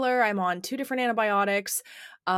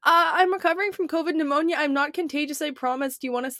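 mock apologetic explaining; 8 semitones higher than usual. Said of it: "I'm not just gonna go".

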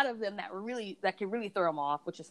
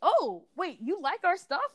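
much higher, startled double take; brand staff meeting the reviewer, high startled question; surprised brand staff; 11 semitones higher, slower than usual. Retold or imitated. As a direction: realize who she is and blurt it out. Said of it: "they're like".

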